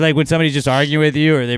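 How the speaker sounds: normal talking voice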